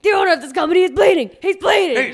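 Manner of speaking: hammed-up ringside panic, fast at the end; Jim Ross shouting. Commentator yelling about the bleeding owner, mock frantic shout. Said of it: "J R is always like".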